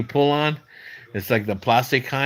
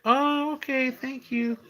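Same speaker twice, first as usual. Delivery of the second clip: very high, mock-polite voice; imitated polite visitor; slower than usual. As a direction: play the visitor in a high falsetto. Imitating the visitor accepting refusal.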